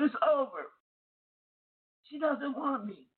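a husband on the phone saying through tears that his wife doesn't want him, mild sobbing; retold crying. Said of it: "he was boo-hoo crying".

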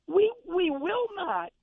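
high, slow, heated insistence; pumping himself up on every word; worked up. Real, not acted. Host lecturing fans, getting himself worked up. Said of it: "I gotta calm myself down".

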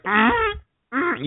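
high, tight mock strangled hum; strangled voice imitation. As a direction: squeeze out a high choked hum. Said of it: "You're like".

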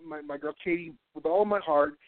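ordinary talk, nothing marked

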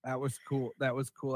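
ordinary talk

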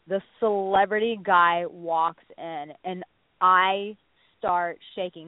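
ordinary talk

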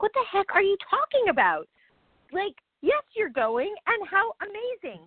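high, stunned what-the-heck; incredulous disbelief. Retold or imitated. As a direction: pitch it up in shock at her refusal. Friend refusing dream trip abroad.

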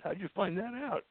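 surprised question. Retold or imitated. Retold surprise at the news.

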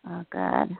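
usual speaking voice

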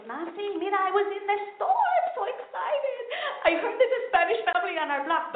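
high, eager gush; excited mom. Retold or imitated. Mother excitedly telling daughter about a Spanish family. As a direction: share the news breathlessly.

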